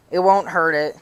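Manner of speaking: normal talking voice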